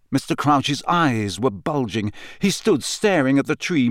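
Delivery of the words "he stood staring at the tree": usual speaking voice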